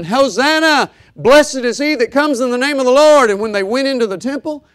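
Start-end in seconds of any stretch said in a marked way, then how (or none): 0.0-3.3 loud, high cry of Hosanna; crowd shouting. Retold or imitated. Crowd shouting at triumphal entry.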